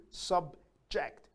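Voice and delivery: SUBJECT as sub, ject; small gap inside subject; split into syllables. Shown as a demonstration. Teacher showing vowels in subject, split into syllables. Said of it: "It has two vowel sounds".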